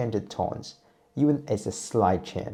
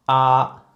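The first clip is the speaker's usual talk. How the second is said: "ah" held high and level, louder; high flat tone; 5 semitones higher, 9 dB louder than usual. Shown as a demonstration. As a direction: sustain "ah" on one high pitch. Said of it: "use a relatively high position".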